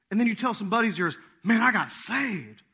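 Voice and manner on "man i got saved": loud, high, drawn-out boast; excited testimony. Acted. New convert telling some buddies, excited. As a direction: announce it slowly and loudly to your pals.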